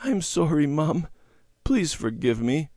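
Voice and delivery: put-on high, pleading little-girl apology; tearful apology. A narrator's reading. Debbie apologizing to her mother, tearful. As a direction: plead for forgiveness, close to tears. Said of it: "tears coming into her eyes".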